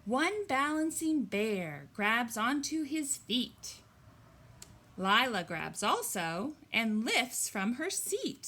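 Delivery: loud, lilting picture-book reading; story reading. Acted. Teacher reading the bear page aloud, bright storytelling voice. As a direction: read it out big for a room of little kids.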